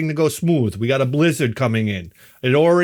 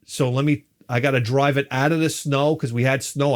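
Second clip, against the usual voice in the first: ordinary delivery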